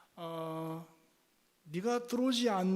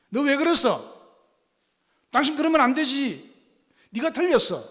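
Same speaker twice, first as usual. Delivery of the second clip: loud, high, accusing you-message; blaming shout; 9 semitones higher, 10 dB louder than usual. Shown as a demonstration. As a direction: snap the blame at them. (소리치며 탓하는 말투)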